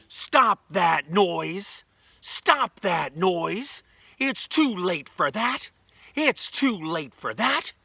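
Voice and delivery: loud, drawn-out scolding holler; hollering townspeople. Acted. Townspeople at windows to the noisy boy, hollering. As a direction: stretch each word and yell it high across town. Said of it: "they'd all holler".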